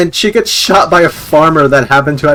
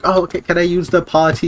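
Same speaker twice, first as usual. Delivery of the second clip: put-on higher, childish little question; put-on innocent child asking permission; mock little kid; 6 semitones higher than usual. Kid asking the psychologist for bathroom, childish. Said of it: "the kid was like".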